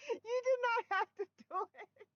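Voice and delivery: high, teary real laughter through the words; squeaking out words while cracking up; laughing. Real, not acted. Streamer teasing Chris about his rant, crying with laughter. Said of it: "I was laughing".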